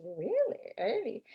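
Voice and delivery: mock old lady's Polish-accented wonder; soft, intrigued thick Polish accent. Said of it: "thick kind of Polish accent".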